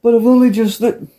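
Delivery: hammed-up coach and lad; bossy coach. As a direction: bark it, then whine back. Coach barking orders at lads.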